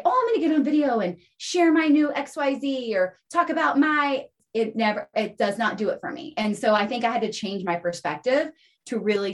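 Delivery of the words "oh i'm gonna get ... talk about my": mock self-promotion. Mimics self-promotion she would balk at.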